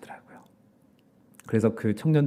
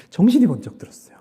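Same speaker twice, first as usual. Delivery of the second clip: higher and louder, hammering the word 정신이; emphatic proclamation; 7 semitones higher, 7 dB louder than usual. Real, not acted. Pastor declares his awakening to the congregation, loud and emphatic. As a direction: proclaim it like a wake-up call. Awakening retold at full force.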